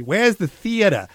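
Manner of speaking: a half-hearted, hammed-up New York accent; bad New York accent. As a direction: imitate a New Yorker tourist asking, badly. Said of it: "that was a bad accent".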